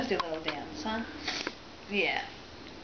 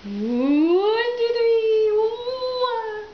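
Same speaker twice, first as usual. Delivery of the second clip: rising, louder and higher count; excited building count; 12 semitones higher, 8 dB louder, much slower than usual. Real, not acted. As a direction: build up the count to a big burst. Counting up to baby.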